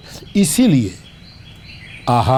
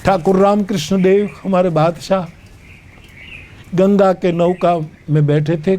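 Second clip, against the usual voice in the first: usual speaking voice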